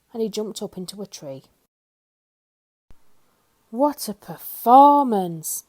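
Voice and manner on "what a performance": slow, loud drawn-out sarcastic remark; dry worm comment. A narrator's reading. The worm mocking Mister Jelly's fuss in a slow odd voice. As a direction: drawl it like an unimpressed worm. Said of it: "commented the worm".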